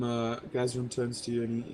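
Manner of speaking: ordinary delivery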